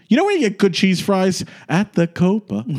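high, bright showbiz segue; showy lounge voice. Retold or imitated. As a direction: sell the Copa like a cabaret star. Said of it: "be like".

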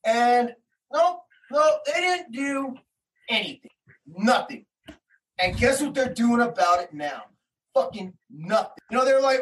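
ordinary talk

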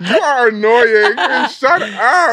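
mock-annoyed through laughter; laughing. Real, not acted. Laughing, telling friend off.

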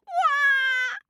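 put-on loud high baby cry; baby wail. Baby crying in story.

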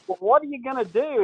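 high perky reporter question; young reporter. Retold or imitated. Reporter asks the golfer his plans, high chirpy voice. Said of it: "she's like".

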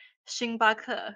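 星巴克 said in Mandarin tones; Mandarin for Starbucks. Shown as a demonstration. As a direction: say 星巴克 the Chinese way. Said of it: "for example, Starbucks is".

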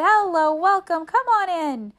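high, very loud overeager greeting; crying out welcome. A narrator's reading. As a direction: shout an overdone welcome to cover up. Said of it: "Arthur cried".

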